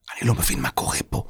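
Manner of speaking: hushed, quick whisper; whispering fast in confusion. A narrator's reading. Boy confused in strange place. (לוחש במהירות)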